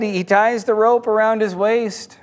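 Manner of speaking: plain reading voice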